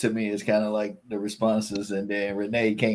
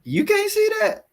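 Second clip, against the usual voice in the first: surprised; 5 dB louder than usual. Real, not acted. Surprised someone missed it.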